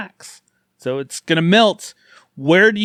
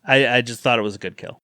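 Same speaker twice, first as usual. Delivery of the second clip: ordinary talk, nothing marked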